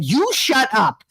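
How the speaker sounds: slow, high playful retort; mock indignant. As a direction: fire back at a teasing mother, drawing it out. Mock retort to teasing mother.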